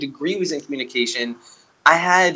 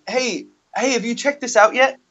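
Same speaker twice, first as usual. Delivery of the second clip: higher, cheery canvasser call; sales pitch voice; 7 semitones higher than usual. Retold or imitated. Canvasser hailing theater crowd, high and chipper. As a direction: chirp it at passing strangers. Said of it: "That was my".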